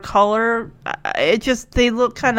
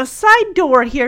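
ordinary delivery

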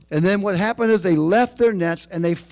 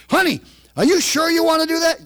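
high, fast questioning spouse voice; nagging spouse; 9 semitones higher, faster than usual. Retold or imitated. Spouse second-guessing a decision, high and fast. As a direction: pepper him with doubts, fast and high.

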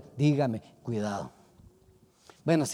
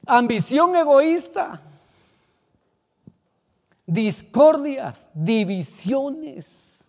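louder, higher, drawn-out heading; loud slow proclamation; 8 semitones higher, 7 dB louder, much slower than usual. Acted. Preacher naming sins to the church, loud and slow. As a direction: call out each sin slowly.

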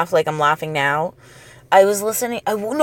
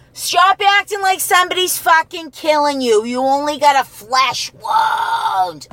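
scolding parent; 7 semitones higher than usual. Retold or imitated. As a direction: scold a crying kid, eighties style. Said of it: "it's like".

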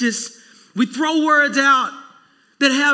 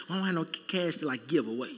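sheepish excuse; 6 semitones lower, 10 dB quieter, faster than usual. Retold or imitated. Retelling his own lie.

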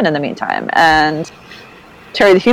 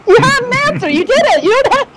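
exasperated; 11 semitones higher, slower than usual. Real, not acted. Host exasperated by hedged answer.

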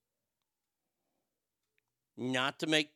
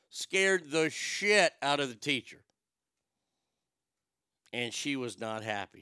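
ordinary talk

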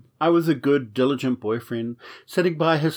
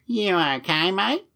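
hammed-up Aussie lifeguard, drawn out; mock Australian accent, pitched up and slowing down; 6 semitones higher, slower than usual. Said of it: "saying to you with an Aussie accent".

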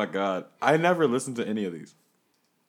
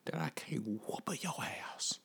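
whisper; 8 dB quieter than usual. Acted. Whispered skit line.

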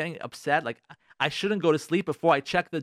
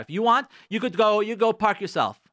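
higher, louder mock spousal retort; defiant retort; 6 semitones higher, 4 dB louder than usual. Wife refusing her husband's parking request, defiant retort. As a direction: toss the refusal back at a spouse, acted. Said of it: "speak like this in their marriage".

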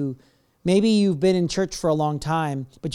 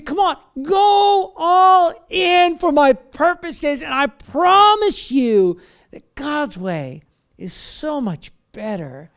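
crying out high and loud, stretched slow; impassioned plea; 9 semitones higher, 7 dB louder, much slower than usual. Acted. Voicing God to the congregation, a loud slow cry. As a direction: plead with all your heart, drawn out.

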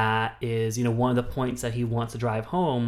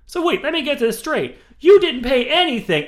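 much higher and louder, hammed-up shock; mock astonishment; 14 semitones higher, 9 dB louder than usual. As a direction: overplay the disbelief. [name] hamming up disbelief.

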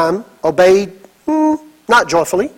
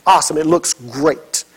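low tender praise from a father; praising son; 7 semitones lower, 5 dB quieter than usual. Retold or imitated. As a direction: gently tell your grown son well done. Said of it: "I told him".

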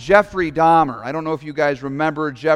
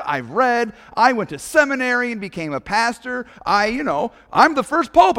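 higher, self-righteous bragging protest; indignant Peter; 7 semitones higher than usual. Retold or imitated. Peter boasting to Jesus, higher and indignant. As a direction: list your good deeds like an offended apostle, pitched up. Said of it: "someone with Peter's mindset saying".

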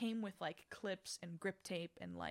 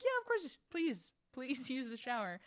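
eager friend voice; 5 semitones higher than usual. Retold or imitated. Friend eagerly offering shower.

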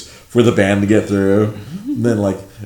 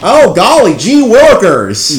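high, loud goofy gee-whiz voice; naive bystander voice; 10 semitones higher, 8 dB louder, much slower than usual. Retold or imitated. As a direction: play a clueless gawker. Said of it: "standing there like".

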